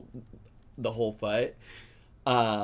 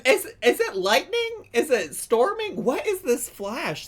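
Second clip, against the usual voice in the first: high, louder startled questions; 12 semitones higher, 6 dB louder than usual. Real, not acted. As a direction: react as if a flash just went off. Startled by flashes on screen.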